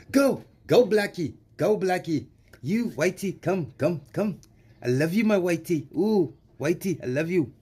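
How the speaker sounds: high, mocking South African accent. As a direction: shoo one away and coo to the other in South African accent. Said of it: "I'm doing this with a South African accent".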